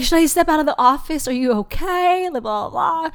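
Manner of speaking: a hammed-up boyfriend asking if she's okay; imitating boyfriend. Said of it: "And he's like".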